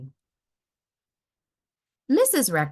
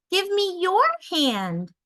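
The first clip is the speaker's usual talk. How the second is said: put-on high, bright child voice; a small kid asking sweetly; eager child; 12 semitones higher, 4 dB louder than usual. A narrator's reading. Child speaks to mother.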